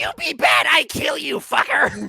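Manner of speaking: loud, high broken-English kobold. Acted. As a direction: shout it like a crude little kobold.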